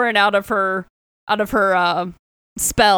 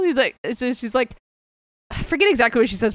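ordinary delivery

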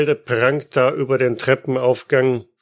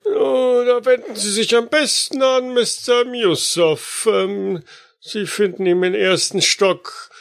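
high, eager doorman voice; old porter voice; 8 semitones higher than usual. Acted. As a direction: play the old porter, pitched up. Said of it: "ein etwas älterer Portier".

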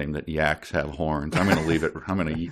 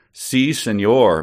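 put-on Puerto Rican accent; 4 semitones higher, 6 dB louder, slower than usual. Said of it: "Midge cackled in a thick Puerto Rican accent".

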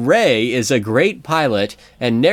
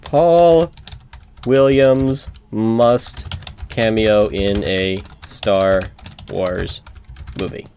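slow dictation; much slower than usual. Pretend. Dictating an online petition.

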